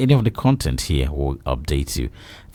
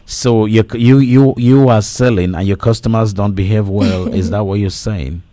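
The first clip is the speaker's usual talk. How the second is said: loud and fast, raised for a bad phone line; to someone hard of hearing; 6 semitones higher, 6 dB louder than usual. Real, not acted. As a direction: project so the caller can hear.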